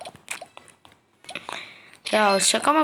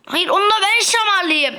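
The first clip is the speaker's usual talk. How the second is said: eager insistence; 7 semitones higher than usual. Real, not acted. Fighting players for a slap.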